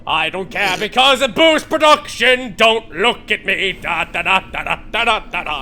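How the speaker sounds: loud, slow villainous factory chant; hammed-up robber baron voice; gleeful industrialist. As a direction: hum and gloat like a heartless tycoon. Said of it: "Rabbit, Iron Man, industrialist".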